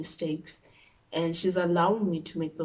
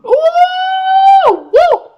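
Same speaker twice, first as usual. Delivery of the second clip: long, piercing whoop, then a short one; high "ooh" howl; 20 dB louder than usual. Acted. Vlogger reacting to the phone demand, high howl. Reacting to her own reveal.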